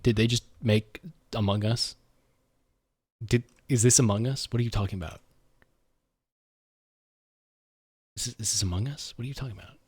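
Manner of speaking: soft, low, puzzled muttering to himself; disbelieving mutter. Real, not acted. Streamer murmuring at a Nintendo announcement, baffled. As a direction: murmur it half to yourself, baffled.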